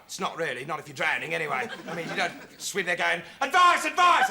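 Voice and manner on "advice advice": louder, higher mock cry for help; mock shout. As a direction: yell it like a drowning man. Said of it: "they just shout".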